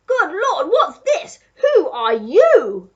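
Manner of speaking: loud, high, flabbergasted outburst; duke gasping. A narrator's reading. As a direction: build from shock to a slow, booming question. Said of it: "he gasped".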